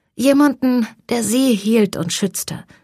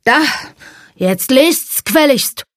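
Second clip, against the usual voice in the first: put-on drunken demand; 5 semitones higher, 4 dB louder than usual. A narrator's reading. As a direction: bark it like a drunk bully. Drunk son demands they read. (lautstark betrunkener Befehl)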